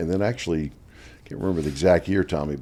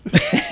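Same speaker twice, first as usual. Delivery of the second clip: sudden high laugh; burst of laughter; 4 dB louder than usual. Real, not acted. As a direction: laugh out loud at the joke. Laughing at teammate's height joke.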